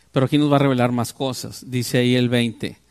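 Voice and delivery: normal talking voice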